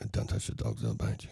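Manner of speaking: barely audible, low murmured warning; very soft-spoken. Retold or imitated. Dog owner warning a lady off his dogs, very softly. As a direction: imitate a soft-spoken man, almost whispering. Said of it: "he used to speak very softly".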